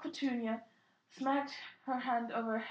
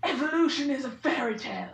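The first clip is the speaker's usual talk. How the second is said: loud scoffing; 7 dB louder than usual. Acted. Hagrid scoffing at the aunt.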